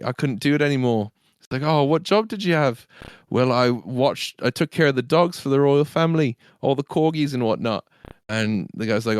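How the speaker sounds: British lad telling a pub joke; matey put-on British storytelling; joke-telling stable boy. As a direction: lightly act out both sides in a British accent.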